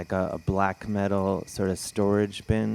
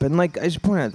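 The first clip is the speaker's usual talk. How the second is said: usual speaking voice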